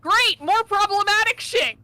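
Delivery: high, loud sarcastic cheer; excited shout. Real, not acted. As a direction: yell it gleefully. Excited outburst out of character.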